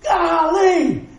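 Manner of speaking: high, loud frustrated blurt; exasperated exclamation. Retold or imitated. Preacher reliving his meltdown, a loud, high exasperated blurt. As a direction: let it burst out in exasperation. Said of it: "I was like".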